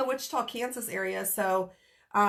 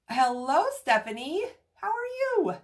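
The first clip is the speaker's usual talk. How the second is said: excited greeting; 6 semitones higher, 3 dB louder than usual. Real, not acted. Greeting a new viewer on live.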